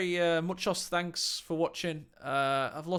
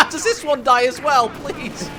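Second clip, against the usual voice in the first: excited; 6 semitones higher, 10 dB louder than usual. Real, not acted. Caster laughing at play.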